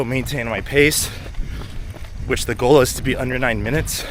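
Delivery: out of breath mid-run; running while talking. Real, not acted. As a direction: talk while running hard. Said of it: "before we start the progression run".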